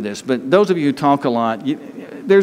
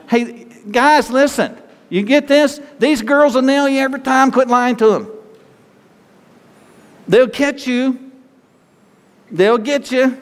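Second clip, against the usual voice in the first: playful, raised pitch, ribbing the men; teasing aside; 9 semitones higher than usual. Real, not acted. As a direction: tease the guys about getting caught.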